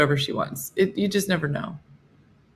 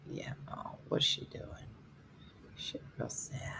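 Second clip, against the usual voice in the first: hushed unvoiced whisper; 5 semitones lower, 19 dB quieter than usual. Real, not acted. Owner whispering about her sad dog nearby. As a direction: whisper so the dog won't notice.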